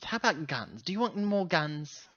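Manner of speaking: ordinary talk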